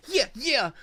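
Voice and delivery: put-on high cute child's yeah; mock little girl cheering; cute kid voice. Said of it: "where it was just a cute".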